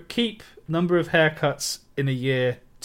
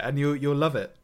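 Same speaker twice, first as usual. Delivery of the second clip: ordinary talk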